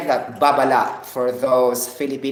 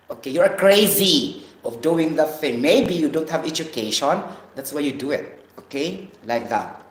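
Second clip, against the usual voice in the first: ordinary talk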